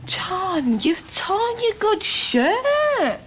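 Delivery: high-pitched, drawn-out dismay; dismayed fussing. Acted. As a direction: exclaim over it, pitch climbing high. Dismay at a torn shirt.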